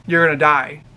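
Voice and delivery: usual speaking voice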